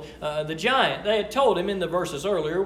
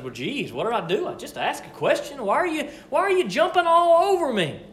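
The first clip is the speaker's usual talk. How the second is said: whiny little brother; 6 semitones higher than usual. Retold or imitated. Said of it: "being a little brother".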